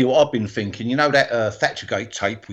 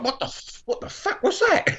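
baffled outburst. Retold or imitated. Acted bewildered reaction.

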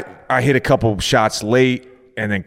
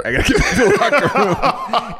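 laughing; 14 semitones higher, 3 dB louder than usual. Retold or imitated. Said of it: "I'm like".

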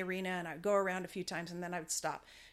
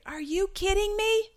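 much higher, louder, mock disbelief; incredulous outburst; 12 semitones higher, 7 dB louder than usual. Instructor's held-back reaction to her friend, hammed-up disbelief. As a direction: let the unspoken disbelief burst out. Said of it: "because I wanted to say".

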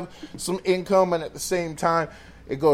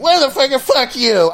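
high, loud yelling back, imitated; retold angry yell; 9 semitones higher, 7 dB louder than usual. Imitating a smoker yelling at security. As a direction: snap back at him hard, loud and high.

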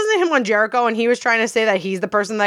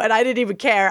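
ordinary talk, nothing marked

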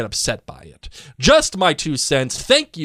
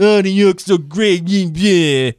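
louder, higher mock gushing; mocking imitation; 4 semitones higher, 5 dB louder than usual. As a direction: parody the New York fans. Mocking fans of New York.

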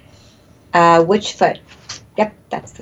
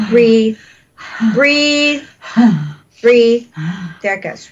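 healer's breathing coaching; 2 semitones higher than usual. Acted. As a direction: coach each breath, then settle.

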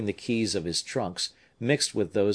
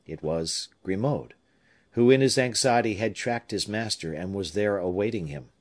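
ordinary delivery, nothing marked

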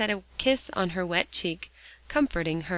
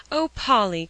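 a sharp dismayed cry, louder; 3 semitones higher, 7 dB louder than usual. A narrator's reading. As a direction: call her name in alarm. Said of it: "cried Alexia in dismay".

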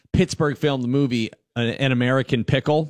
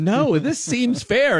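content customer; 11 semitones higher, slower than usual. Retold or imitated. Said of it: "And he's like".

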